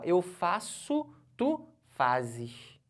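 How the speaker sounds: eu faço, tu fazes drawn out slowly, each word set apart; teacher showing the class the change, spelling out eu faço, tu fazes slowly; slowed conjugation demo. Shown as a demonstration. The teacher slows down to show the change in the verb.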